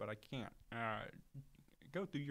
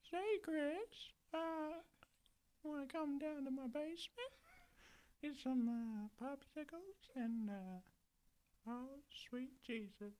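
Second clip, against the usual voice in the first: put-on very high, slow old-man impersonation; high cartoon old man, aiming for a whistle; old man from Family Guy; 12 semitones higher, slower than usual. Trying the creepy invitation to Chris, high old-man voice. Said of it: "I try and get that whistle in there".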